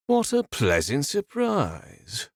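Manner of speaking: lightly voiced lilting villain. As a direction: let it lilt, savouring it. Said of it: "said in a lilting voice".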